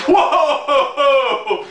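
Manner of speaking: high laughing whoa of disbelief. Retold or imitated. A rider offered a seat, stunned and laughing whoa. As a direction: let a laugh burst through a stunned whoa.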